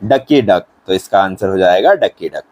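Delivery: normal talking voice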